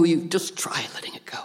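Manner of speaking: soft whispered advice. Pretend. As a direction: breathe it out gently, no voice. Gentle advice voiced to friend.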